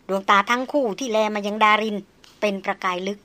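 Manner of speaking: usual speaking voice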